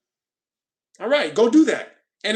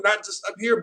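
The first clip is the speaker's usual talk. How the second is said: ordinary delivery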